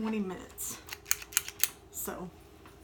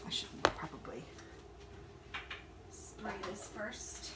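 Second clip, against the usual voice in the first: normal talking voice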